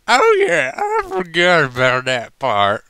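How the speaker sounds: put-on a goofy tipsy southern accent; hammed-up southern drunk remembering; drunken southerner. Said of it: "I do quite a good drunken southerner".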